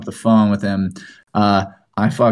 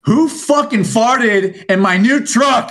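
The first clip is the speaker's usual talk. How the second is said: high, slow, laughing imitation of an outraged friend; mock outraged truck owner; 11 semitones higher, 3 dB louder, much slower than usual. S1 imitating Matt yelling about his new truck, high laughing mock outrage. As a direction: whine it out through laughter, mocking Matt's outrage. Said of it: "Matt said something like this".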